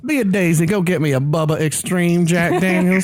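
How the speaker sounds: a light Southern drawl, unhurried and lazy; mild Southern drawl of a gunslinger. Retold or imitated. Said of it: "lazy Southern drawl".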